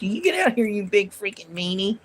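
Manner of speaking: high, silly pretend threat; squeaky toothless telling-off; mock wimpy threat. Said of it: "I said".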